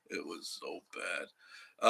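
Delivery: slow dragged repeat. Real, not acted. Telling viewers about awful hospital food, slow and drawn out.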